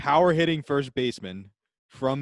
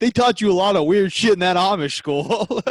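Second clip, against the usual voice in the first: loud, higher mock outburst; shocked teammate; 6 semitones higher, 7 dB louder than usual. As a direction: play a teammate loudly calling him out.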